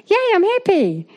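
high, swooping "yay"; hammed-up high-pitched delight; playful cheer. Playful cheer about being home.

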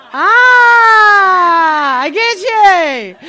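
loud, high cry of realisation, slowed; a loud, slow, high cry of understanding; sudden realisation. Retold or imitated. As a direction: burst out as it clicks. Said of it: "my brain goes".

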